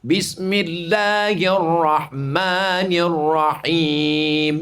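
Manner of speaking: very slow, every long vowel held; long vowels recited. Shown as a demonstration. Preacher reciting bismillah to show the long vowels, very slow. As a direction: recite it slowly, stretching each madd. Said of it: "Nabi membaca bismillah tiga kalimat".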